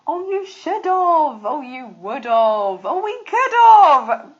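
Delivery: hammed-up sloppy speaker, drawn out; mock wrong usage. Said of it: "that's now becoming quite common".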